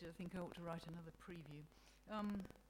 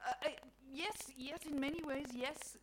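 hesitant, higher reflective God; 8 semitones higher, 6 dB louder than usual. A narrator's reading. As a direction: ponder it with halting agreement. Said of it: "God says reflectively".

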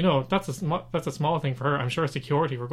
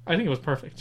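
mock smug; 2 semitones higher than usual.

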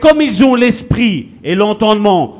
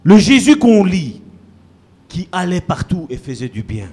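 normal talking voice

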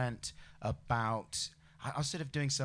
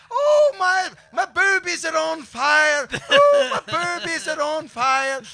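very high, loud panicked wail; hammed-up Mrs Doubtfire with burning boobies; impression of Joe's Mrs Doubtfire; 12 dB louder, slower than usual. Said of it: "Joe was doing this impression that he does".

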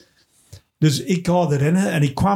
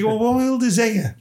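slow challenging question; 8 semitones higher than usual. Retold or imitated. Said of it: "Ik zeg".

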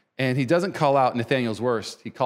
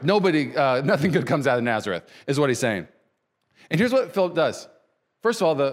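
ordinary talk, nothing marked

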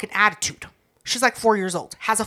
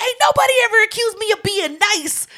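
high-pitched retold outburst; going off; 10 semitones higher than usual. Aunt snapping back at being called nice, going off. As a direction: protest it high and indignant. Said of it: "I went off".

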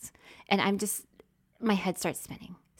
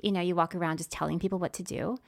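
normal talking voice

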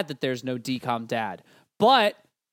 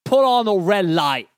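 loud, high Cockney bloke; mock Cockney; 5 semitones higher, 7 dB louder than usual. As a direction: bark it back like a London mate. Joins the Cockney bit.

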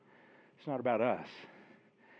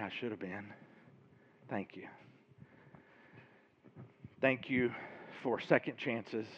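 closing prayer; 2 semitones lower than usual. Real, not acted. Pastor praying over the church, softening earnest prayer.

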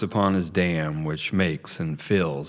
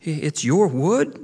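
put-on startled kid voice; 8 semitones higher, 4 dB louder than usual. A narrator's reading. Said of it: "the young beaver said".

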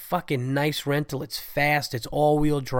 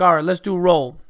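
raised, louder teacher voice; substitute teacher; 5 semitones higher, 7 dB louder than usual. Retold or imitated. Teacher starting roll call, loud and brisk-sounding substitute. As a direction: call the class to order as the substitute. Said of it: "is a substitute teacher".

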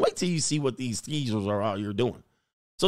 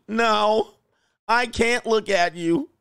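higher, slow mock whine; mock upset woman; 6 semitones higher, slower than usual. Turning away from the camera in a mock whine. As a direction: act too upset to look, hammed.

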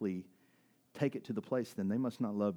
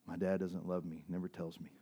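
retold sad confession; 5 semitones lower, 7 dB quieter than usual. Retells a small crying child's confession.

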